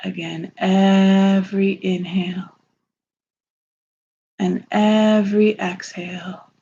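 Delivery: slow, soothing meditation voice with long pauses; unhurried, calming lead through a visualization; guided meditation. Acted.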